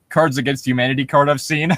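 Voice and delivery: ordinary talk, nothing marked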